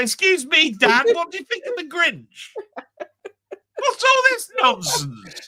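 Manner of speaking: a mock son, ending in a laughing shout; lightly voiced son asking his dad. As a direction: play the son popping his head round the door, ending high and laughing. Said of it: "pop his head round the corner and say".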